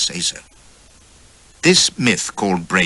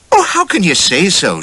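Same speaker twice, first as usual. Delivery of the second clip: put-on high, louder young wife, lightly amused; laughing wife; 11 semitones higher, 5 dB louder than usual. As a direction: tease her husband, pitched high. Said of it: "she laughed at me in the mirror".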